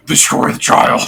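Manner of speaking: breathy demon whisper; demonic whisper. Retold or imitated. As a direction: hiss it like a dark spirit. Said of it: "interpretation of your voice".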